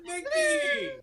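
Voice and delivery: very high, laughing cheer; excited squeal. Real, not acted. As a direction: shriek the name with joy. Host cheering the guest.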